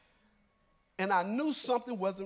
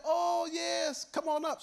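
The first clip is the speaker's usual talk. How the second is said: woman's welcome; 10 semitones higher, slower than usual. Retold or imitated. He voices the woman answering.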